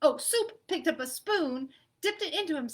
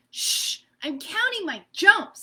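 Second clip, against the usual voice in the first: shushing annoyed jumper; 8 semitones higher, 10 dB louder, slower than usual. Acted. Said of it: "said Meatball".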